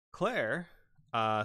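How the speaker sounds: ordinary talk, nothing marked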